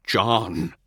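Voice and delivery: a warning bark of his name; a mild growl at an old friend. Acted. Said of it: "All almost growls the warning".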